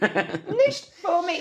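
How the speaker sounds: slow, high, laughing catchphrase; drawn-out catchphrase. Acted. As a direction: stretch every word while giggling.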